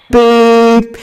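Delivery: high, loud, sustained beep; censor beep. Acted. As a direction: imitate a bleep over a swear word. Censors a word with imitated noise.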